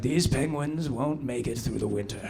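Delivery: lightly voiced solemn presenter; grim Attenborough. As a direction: hush it like a sad nature documentary. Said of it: "he's always like".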